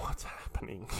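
soft, breathy, unvoiced fear; whispered panic. Teller whispering his confusion in the dad's study. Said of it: "I'm like".

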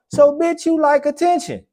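high, loud mocking jab; mocking shout. Real, not acted. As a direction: squeal it out, calling her out. Mocking punchline at the woman.

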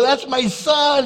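high, loud, drawn-out proclamation; excited proud shout. Retold or imitated. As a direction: announce it to the whole room, thrilled. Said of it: "I was so excited".

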